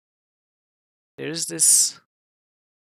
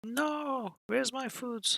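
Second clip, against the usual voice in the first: much higher, squeaky mock protest; mock outrage; 11 semitones higher, much slower than usual. Gamer protesting lost food rations, high mock outrage. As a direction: whine it like a robbed kid.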